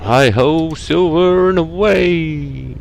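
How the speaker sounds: long, drawn-out high call; cowboy call. Acted. Driver setting off with a cowboy catchphrase, drawn-out call. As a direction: stretch it out high like a rider's cry.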